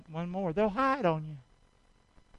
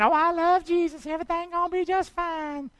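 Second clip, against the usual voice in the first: higher, carefree sing-song of a careless believer; mock naive believer; 12 semitones higher, 5 dB louder than usual. Preacher mocking a complacent believer to his church, in a higher carefree voice. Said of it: "Don't go around thinking".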